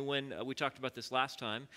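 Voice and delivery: ordinary talk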